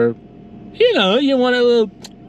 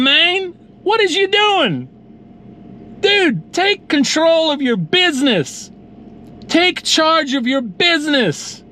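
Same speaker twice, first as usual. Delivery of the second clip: incredulous scolding; 7 semitones higher, slower than usual. Retold or imitated. Scolding friend in story.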